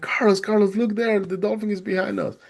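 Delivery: high, eager shout of a name; excited calling out. Retold or imitated. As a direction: point it out before it's gone. Rescuer calls out about dolphin.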